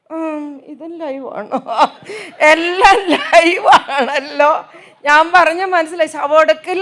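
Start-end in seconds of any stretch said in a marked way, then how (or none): 0.1-1.6 amused laugh. Real, not acted. Amused question, laugh heard. 2.5-4.5 slow, laughing echo of എല്ലാം ലൈവാണല്ലോ; mock-repeating the girl's words with a chuckle. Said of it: "repeats the girl's line laughing".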